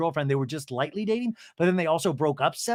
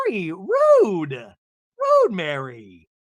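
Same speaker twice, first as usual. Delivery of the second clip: mock indignant scolding; 6 dB louder, much slower than usual. [name] scolds Mary for not caring, mock indignant. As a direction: draw it out in hammed-up offence.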